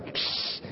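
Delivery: short unvoiced psst of scorn; scoffing psst; dismissive hiss. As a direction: brush it off with a hiss. Dismissive noise at the prayer.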